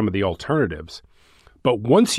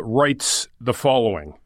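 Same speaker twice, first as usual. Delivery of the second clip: ordinary delivery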